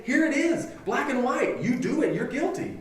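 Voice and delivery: high-pitched, emphatic pronouncement; preacher voicing God's firm ruling; emphatic verdict. God's verdict voiced in sermon.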